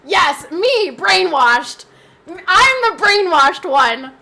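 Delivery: loud, high sarcasm, slowing on "I'm the brainwashed one"; sarcastic. Real, not acted. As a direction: throw the accusation back, mocking. Mocking a hater's comment.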